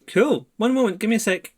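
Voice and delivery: high-pitched survivor reply; voice from inside. Acted. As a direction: answer high from behind the barricade. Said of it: "You hear a voice from inside".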